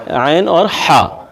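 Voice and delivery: عین and ہا said slowly from the throat; slow letter demo. Shown as a demonstration. Teacher repeats the throat letters for students, slow. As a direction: draw out عین اور ہا. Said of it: "ہا بھی middle part سے ہے".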